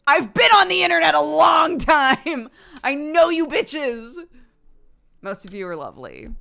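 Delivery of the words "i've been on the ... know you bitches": loud, high, half-joking shout at the trolls; playful loud rant; mock shout. Real, not acted. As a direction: call out the internet crowd. Mock warning to internet trolls.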